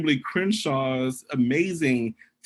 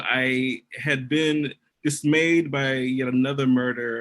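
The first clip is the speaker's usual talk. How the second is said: ordinary talk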